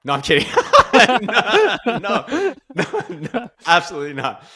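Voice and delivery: high, loud denial through big laughter; laughing. Real, not acted. Interviewee joking about wife, laughing through the retraction. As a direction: crack up while taking back the joke. Joke blaming wife, then takes back.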